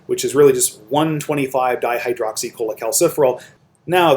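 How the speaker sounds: ordinary talk, nothing marked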